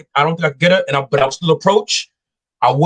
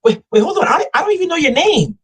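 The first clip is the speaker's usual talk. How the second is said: much higher, mock-flustered woman's voice; imitated intrigued woman; 12 semitones higher than usual. Chick realizing she lacks his name, mock flustered. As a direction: stop him short in a high imitated voice. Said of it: "She'll be like".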